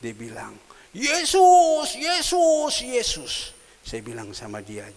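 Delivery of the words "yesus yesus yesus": high, loud jeering call from afar; mock the name across the pool; a neighbour's taunting yell, fading; mocking shout. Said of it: "dia teriak dari jauh".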